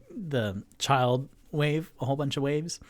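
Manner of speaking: ordinary delivery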